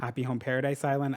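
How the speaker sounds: ordinary delivery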